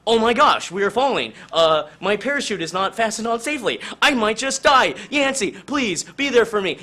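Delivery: husband falling without a safe parachute, mock panicking; panicked pleading. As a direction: beg for help, hammed-up terror. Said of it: "Jonesy's basically like".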